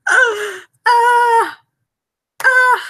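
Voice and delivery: high, loud squeals of delight; repeated happy shrieks; joyful squeals. Real, not acted. Streamer celebrates the working camera, squealing with joy.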